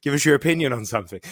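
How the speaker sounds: fast, loud laughing demand; laughing tease. Real, not acted. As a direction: tease her while laughing. Teasing viewer Lisa.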